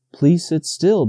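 plain reading voice

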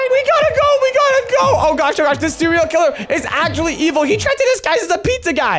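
high frantic shouting; panicked yelling. Acted. Escaping killer, frantic.

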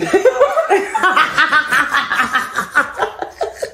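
long rising burst of real laughter; just crack up; big laugh. Real, not acted.

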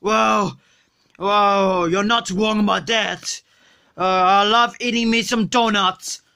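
put-on low slurred drunk voice; sluggish, drunk and lazy; Homer Simpson. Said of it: "You're freaking Homer Simpson".

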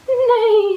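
high, loud neigh; whinny like a horse. Acted. Parent voicing the horse for her child, loud high neigh. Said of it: "said the horse".

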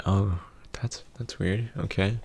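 ordinary delivery

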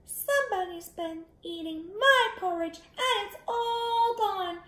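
put-on very high-pitched, tiny baby bear; wee little baby bear voice. A narrator's reading. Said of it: "he said in his wee little voice".

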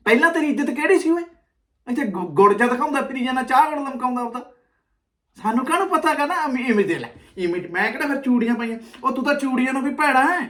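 ordinary talk

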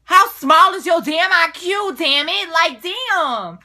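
shouted comeback to a rude question; angry retort. Real, not acted. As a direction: hurl the insult back loud and high. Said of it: "I'm just so full of anger right now".

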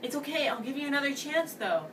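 fast high imitation of the ex; mocking her. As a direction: mimic her breezy offer. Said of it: "she's like".

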